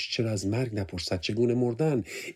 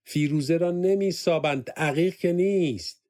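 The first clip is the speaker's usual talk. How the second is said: louder and higher, the last words slowed; a raised, correcting shout; 7 semitones higher, 4 dB louder than usual. A narrator's reading. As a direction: call out sharply across the room. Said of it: "صدفان داد زد".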